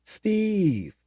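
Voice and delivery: high mock yell of the name; called-out name. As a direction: shout it back across the sea. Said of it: "And he goes".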